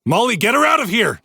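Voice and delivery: high, fast urgent yell; shouted. A narrator's reading. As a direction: order her out fast. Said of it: "Jay shouted".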